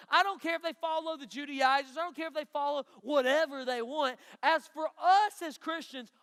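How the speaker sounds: voicing Paul, loud and high with full conviction; forceful preaching. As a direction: proclaim it as Paul would, pressing hard. Preacher voicing Paul's declaration.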